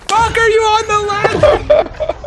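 loud high yell breaking into laughter; laughing yell. Real, not acted. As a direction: shout in laughing disbelief. Exasperated laughing at teammate.

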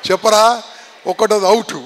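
usual speaking voice

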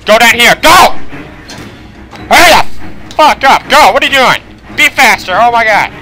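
high, loud shouts to hurry; frantic urging. Real, not acted. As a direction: yell orders in a panic. Urging character away during chase.

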